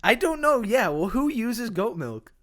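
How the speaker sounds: high, loud protest; exasperated. Real, not acted. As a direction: throw the question back, loud and high. Pushing back on goat milk question.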